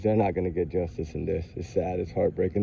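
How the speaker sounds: low, soft and heartbroken; upset. Real, not acted. Grieving for the victims' families, softly heartbroken. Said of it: "I'm just upset and frustrated".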